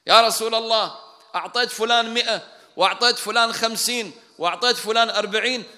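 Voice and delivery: loud, high-pitched shouted protest; shouting complaint. Retold or imitated. Abbas ibn Mirdas protesting to the Prophet, shouting. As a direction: shout out the grievance, item by item. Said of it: "وهو يصرخ".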